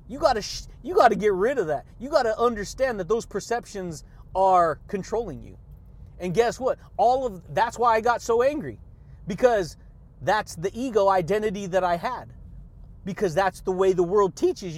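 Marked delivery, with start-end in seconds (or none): none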